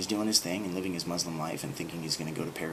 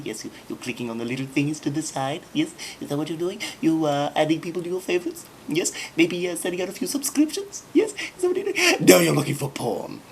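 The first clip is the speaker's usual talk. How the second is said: mock-suspicious questioning that climbs higher; teasing interrogation; 3 semitones higher than usual. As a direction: needle him, building to a squeaky gotcha. Teasing a viewer named Brian.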